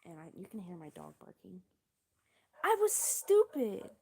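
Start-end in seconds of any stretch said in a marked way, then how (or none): 2.6-3.9 mock self-scorn. Mocking her past self.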